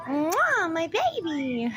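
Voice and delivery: lightly voiced, high parrot kiss; a hint of a talking bird; bird impression. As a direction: imitate a cooing parrot. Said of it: "that goes".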